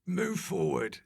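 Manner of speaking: isolated game commands with gaps; game character giving directions; video game voiceover. Shown as a demonstration. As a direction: bark out game instructions one by one. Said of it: "a voiceover on a video game".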